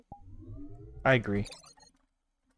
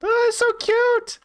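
cooing squeal; 5 dB louder than usual. Real, not acted. Streamer coos at a tiny friend in a high squeal. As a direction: gush over a tiny cute thing.

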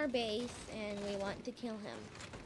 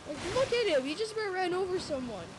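panicking gamer; 7 semitones higher, 5 dB louder than usual. Real, not acted. Exasperated at teammate driver.

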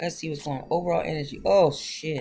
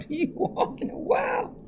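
laughing. Real, not acted. Greeting viewers after long absence.

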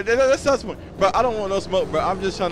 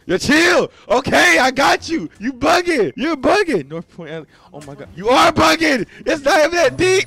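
shouting; 14 semitones higher, 13 dB louder than usual. Real, not acted. Yelling at player to chill.

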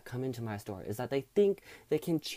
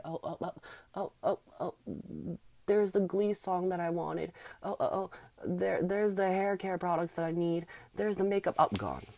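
mock wail; 5 semitones higher than usual. Mock lament over lost money.

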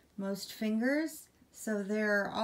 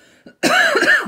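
loud cough; 18 dB louder than usual. Real, not acted. Sudden cough mid-sentence.

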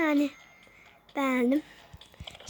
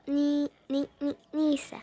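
ordinary delivery

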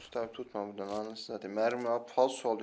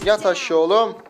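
loud, higher-pitched yell, rising at the end; shouted order; 5 semitones higher, 11 dB louder than usual. Real, not acted. Gamer ordering an opponent down, shouted.

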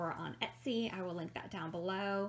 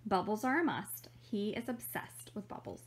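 ordinary talk, nothing marked

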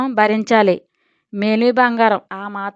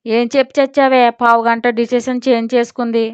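plain reading voice

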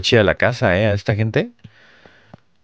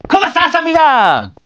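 much higher, playful falsetto voice; high falsetto greeting; 4 dB louder than usual. Pretend. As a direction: squeal it like a giddy girlfriend. Greeting a friend in put-on voice.

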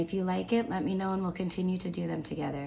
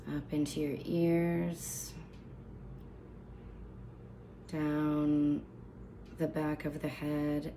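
guided meditation; 7 dB quieter than usual. Real, not acted. Instructor leading listeners through a body scan, calm guided meditation. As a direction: guide them calmly down the body, unhurried, softening as you go.

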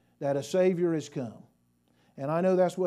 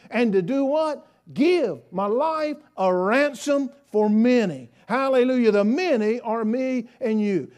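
fervent preaching; 3 semitones higher, 4 dB louder than usual. Real, not acted. Preacher urging church to be thankful for Jesus, fervent preaching. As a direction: preach it with rejoicing, landing hard on each phrase. Preacher rejoices at full force.

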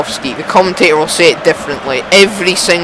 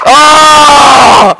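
loud high groan of oh; frustrated shout; 8 semitones higher, 7 dB louder than usual. Real, not acted. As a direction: shout at the missed chance. Missed chance in game.

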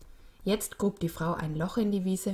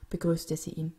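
ordinary delivery

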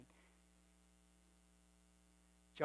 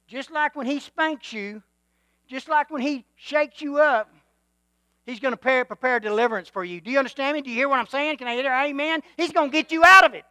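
preaching that swells from warm to a loud cry; rising call and response preaching; building sermon; 7 semitones higher, 11 dB louder than usual. Acted. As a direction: build the congregation up until you shout. Preacher building to full force.

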